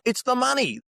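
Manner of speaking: squeaky high mock-client blurt; client confessing. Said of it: "And they go".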